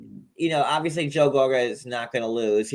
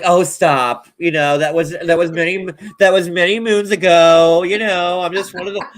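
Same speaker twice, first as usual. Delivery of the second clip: higher-pitched, loud coy protest; put-on bashful voice, pitched up; mock false modesty; 6 semitones higher, 7 dB louder than usual. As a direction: imitate a singer brushing off praise. Mimicking [name]'s false modesty.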